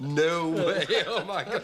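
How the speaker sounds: high, loud and cracking up; laughing exclamation. Acted. Friend repeating the London phrase, loudly laughing.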